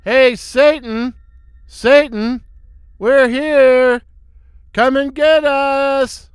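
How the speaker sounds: high, drawn-out taunting call; bellowing. A narrator's reading. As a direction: bellow a slow challenge into the cave. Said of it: "he bellows".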